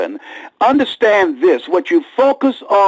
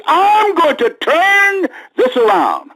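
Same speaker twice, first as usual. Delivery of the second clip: rising preach cry; 13 semitones higher, slower than usual. Acted. Preaching climax.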